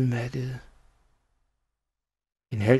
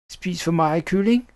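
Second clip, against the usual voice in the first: higher-pitched, smug question; cheeky child's taunt; 6 semitones higher than usual. A narrator's reading. Child feeling clever before the poisoned villain, smug taunt. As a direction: ask it high, pleased with your own cleverness.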